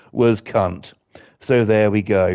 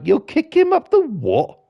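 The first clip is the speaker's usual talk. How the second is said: much higher, startled; shocked question. Retold or imitated. Father shocked at his daughter's slang, high and startled. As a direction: yelp it in disbelief. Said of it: "And I was like".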